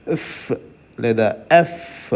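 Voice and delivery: ఎఫ్ with air forced through the lips; f fricative. Shown as a demonstration. Teacher shows the f sound slowly. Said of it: "మనం ఎఫ్ అని పలికేటప్పుడు".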